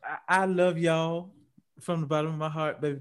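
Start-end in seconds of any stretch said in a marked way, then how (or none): none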